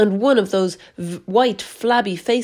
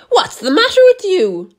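much higher, louder shrieking aunt; screech; 10 semitones higher, 6 dB louder than usual. A narrator's reading. Aunt Spiker screeching at James, high and loud. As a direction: screech at the boy. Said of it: "Aunt Spiker screeched".

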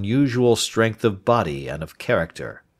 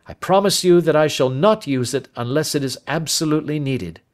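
lightly voiced Holmes, a touch higher; firm but kind detective; a hint of a calm, resolute Holmes; Holmes reassuring; 8 semitones higher, 3 dB louder than usual. Holmes proposes the confession.

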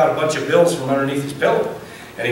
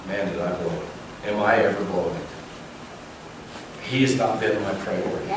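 much lower, soft, broken voice; tearful regret; 8 semitones lower, 9 dB quieter than usual. Retold or imitated. Dad realizing he failed his son, low and soft, near tears. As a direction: let it come out low and quiet, near tears. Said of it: "went into absolute tears".

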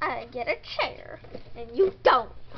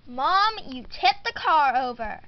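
ordinary delivery

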